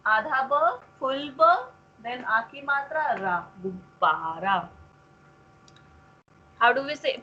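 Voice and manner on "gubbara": "Gubbara" slow and low, with a long BAA; syllable-by-syllable pronunciation. Shown as a demonstration. Teacher models the word for a pupil, slow careful pronunciation of "Gubbara". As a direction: draw out "Gubbara" as gub-BAA-raa. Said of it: "pronounce this word".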